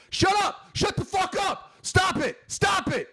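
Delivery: very high, strained yelling orders; mock commanding shout. Peacemaker ordering brawlers to stop, high mock shouting. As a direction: yell it like breaking up a fight.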